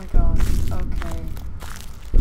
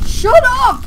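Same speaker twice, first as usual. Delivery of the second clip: shocked shout; 12 semitones higher, 6 dB louder than usual. Real, not acted. Shocked outburst at game.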